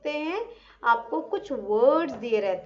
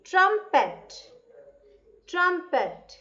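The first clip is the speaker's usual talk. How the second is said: raised repeat; 6 semitones higher, much slower than usual. Shown as a demonstration. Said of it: "T R U M P E T".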